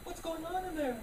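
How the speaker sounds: put-on high-pitched panicked kid asking mom; a scared child's squeaky question. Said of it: "We're just like".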